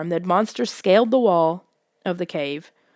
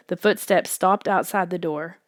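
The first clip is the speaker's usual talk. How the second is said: usual speaking voice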